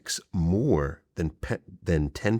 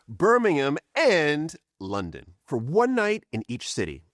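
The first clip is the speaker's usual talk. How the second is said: upbeat ad read, higher and brisk; promo read; 8 semitones higher than usual. Acted. Host pitching his live tour to viewers, upbeat ad read. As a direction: sell the tour like an ad.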